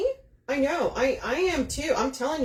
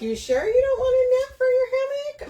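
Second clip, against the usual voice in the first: high, louder mock husband voice; hammed-up husband impression; teasing husband; 14 semitones higher, 5 dB louder than usual. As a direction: tease her in a squeaky put-on voice. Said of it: "He's like".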